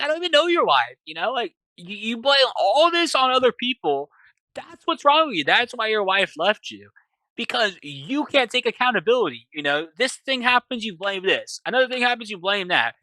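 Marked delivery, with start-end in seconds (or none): 0.0-1.0 high, exasperated retold protest; acted fed-up outburst; indignant denial. Captive drops role-play and protests. 1.8-12.9 high, emphatic acted dressing-down; retold exasperated tirade; scolding. Captive tells captor off for blaming.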